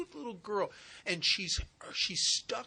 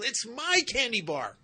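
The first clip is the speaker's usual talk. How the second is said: put-on much higher, louder child's yell; loud, high tantrum of a little girl; stubborn little girl; 8 semitones higher, 7 dB louder than usual. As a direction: wail it like a small girl clutching her prize. Stubborn girl refuses to let go.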